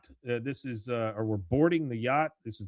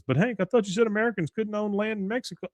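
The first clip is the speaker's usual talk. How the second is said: high, quick puzzled protest; acted clueless friend; 10 semitones higher, faster than usual. Bill, not getting what's going on, high and quick. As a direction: blurt it out high and fast, not catching on.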